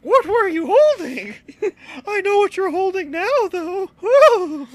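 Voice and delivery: high, loud falsetto; hammed-up girly pitch; mock girl voice. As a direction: coo it in a fake feminine falsetto. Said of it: "this is exactly how our wives sound".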